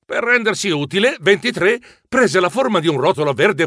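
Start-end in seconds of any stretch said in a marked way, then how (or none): none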